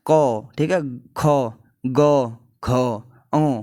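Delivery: क, ख, ग, घ said deep from the throat; reciting from the throat. Shown as a demonstration. Teacher practising the throat letters with the class. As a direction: feel each letter come from the throat. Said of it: "तो करते हैं".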